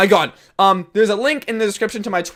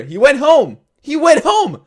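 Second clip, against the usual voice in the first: loud, high, escalating insistence; mock exasperation, climbing on the repeat; exasperated repetition; 8 semitones higher, 6 dB louder, slower than usual. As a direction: hammer it home, louder and higher. Acted exasperated retort to Jim.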